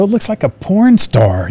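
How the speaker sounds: teasing the builder about the stars; teasing jab. Retold or imitated. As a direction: poke fun at the paint job, drawn out. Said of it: "I go".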